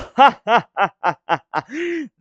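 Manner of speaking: loud high delighted laugh; excited laugh. Real, not acted. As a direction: burst out laughing. Hype after the hit.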